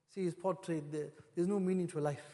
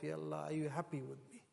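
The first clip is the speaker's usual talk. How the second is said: hushed prayer; 5 semitones lower, 11 dB quieter than usual. Retold or imitated. Quoting a private prayer.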